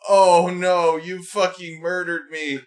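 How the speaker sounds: high, overdramatic wounded wail; mocking whiny victim. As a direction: ham up a fake fatal injury.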